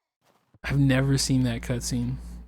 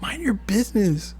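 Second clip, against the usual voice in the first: pitched-up mock indignant girl; angry daughter voice; 6 semitones higher than usual. Abigail scolding her dad about gender norms, mock angry and high. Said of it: "Look at her angry little face".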